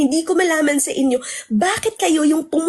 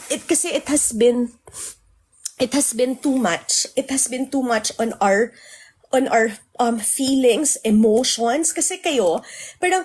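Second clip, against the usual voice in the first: usual speaking voice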